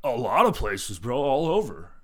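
loud, vague bro drawl; dopey bro voice. Retold or imitated. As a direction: fumble the answer like a clueless bro. Customer's evasive answer acted.

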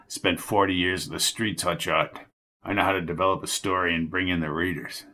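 a hint of a sneering old newsman; lightly voiced old reporter taunting Jones. A narrator's reading. Jerry boasts.

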